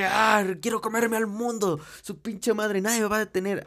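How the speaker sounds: mock fierce, hyped declaration; fired-up roar. Shown as a demonstration. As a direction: say it like a lion hungry for the world. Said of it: "Así levántate".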